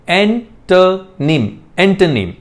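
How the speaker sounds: एंटोनिम slow, en-tə-nim; एंटोनिम drawn out as a demo; correct pronunciation. Shown as a demonstration. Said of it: "एक होता है".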